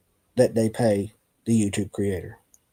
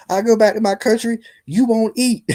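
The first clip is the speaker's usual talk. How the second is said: much higher mock-indignant retort; high-pitched comic comeback; retold telling-off; 9 semitones higher, 4 dB louder than usual. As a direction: imitate someone going off, for laughs.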